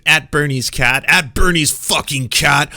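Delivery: loud, indignant mock scolding; imitated outraged call-out. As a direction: act out hammed-up outrage at a tiny target. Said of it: "went out of your way to be like".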